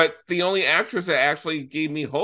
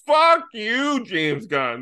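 high, loud mock curse at the director; playfully furious, blaming him; hammed-up tearful anger; mock outrage; 7 semitones higher, 4 dB louder, much slower than usual. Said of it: "And it's like".

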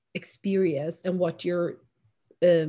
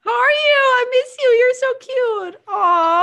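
much higher, louder, gushing with joy; excited gushing; 7 dB louder than usual. Real, not acted. Gushing to her friend Jamie on a video call, thrilled. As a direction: squeal it out, thrilled to see her.

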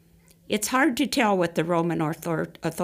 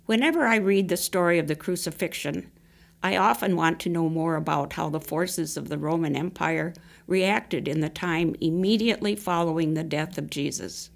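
usual speaking voice

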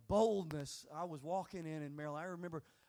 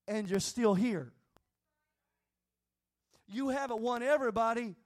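louder, encouraging voice of the Holy Ghost; a firmer, louder divine reassurance; 4 semitones higher, 7 dB louder than usual. Retold or imitated. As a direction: lift him up, louder, as God speaking. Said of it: "the Holy Ghost came over me and just said".

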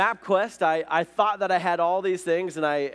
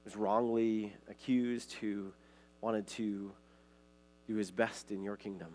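low, soft, unhurried praying voice; prayer; 6 semitones lower, 11 dB quieter than usual. Real, not acted. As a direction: speak softly to God, pitched well down. Leads an opening prayer.

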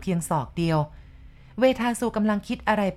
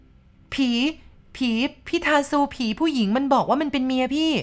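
much higher, halting tearful cries; stammering sob; 7 semitones higher, slower than usual. Acted. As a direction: gasp it out through tears. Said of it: "ตอบตะกุกตะกักเป็นห้วงๆ พร้อมกับร้องไห้". (ตอบตะกุกตะกักพร้อมร้องไห้)

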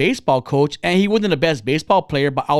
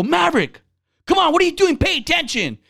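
higher-pitched yell from the stands; 10 semitones higher than usual. Retold or imitated. Uncle to his seven-year-old nephew at a ball game, yelling. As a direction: call out sharply to a kid on the field. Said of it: "And I'm yelling him".

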